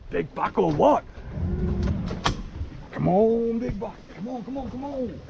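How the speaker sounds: quiet, hushed coaxing. Real, not acted. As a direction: keep it down so you don't spook them. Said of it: "we're just sneaking in".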